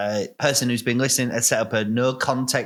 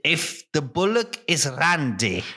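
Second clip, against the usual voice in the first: slow, higher rustic farmer voice; Farmer Buggerton; 4 semitones higher than usual. Acted. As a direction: quiz them like a vaguely Irish farmer. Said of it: "why is he Irish?".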